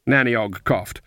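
plain reading voice

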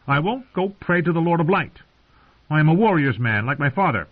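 put-on high-pitched, defiant boy; stubborn boy. A narrator's reading. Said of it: "His mouth set stubbornly".

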